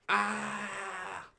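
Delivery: high-pitched yell of disgust; choked cry. A narrator's reading. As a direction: cry out as if choking on a drink. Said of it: "choked rude Ralph".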